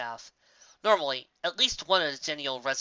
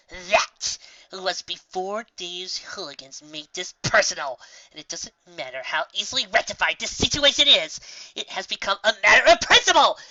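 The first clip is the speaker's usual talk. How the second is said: grand; 4 semitones higher, 7 dB louder than usual. Acted. Trixie grandly declaring principle.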